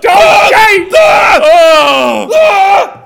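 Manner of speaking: high, loud disgusted shout. Acted. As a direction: yell out in disgust. Shouting after tasting the gravy.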